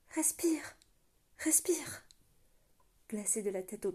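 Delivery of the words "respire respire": breathless whispered plea; urgent whisper. A narrator's reading. Urging her dying love to breathe, in a whisper. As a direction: whisper it, begging them to keep breathing.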